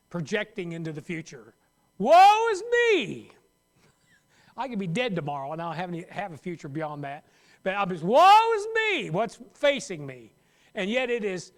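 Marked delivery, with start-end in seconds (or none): 2.0-3.3 high, loud, drawn-out wail; a worrier wailing about tomorrow, hammed-up; mock lament. 8.0-10.3 high, loud, drawn-out wail; a worrier wailing about tomorrow, hammed-up; mock lament.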